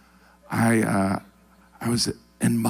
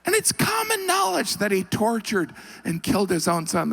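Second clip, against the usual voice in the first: ordinary talk, nothing marked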